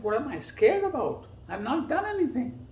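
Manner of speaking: high-pitched alarmed protest, acted out; mock-scared, pitch leaping up; retold trembling fear. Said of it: "And I was trembling".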